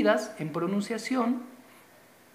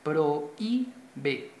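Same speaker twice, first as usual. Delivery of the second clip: "Prohíbe" slowly in pieces, stressing the í. Shown as a demonstration. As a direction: lean on the middle í of "Prohíbe". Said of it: "dónde tiene la mayor fuerza de voz".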